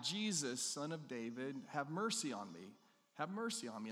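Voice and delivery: ordinary delivery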